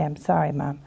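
put-on soft, low sorry child; contrite apology. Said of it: "you should say".

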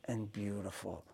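soft, low retold comfort; hushed tender reassurance. Musician finishes comforting the crying man, hushed and tender. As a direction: say it gently, under your voice.

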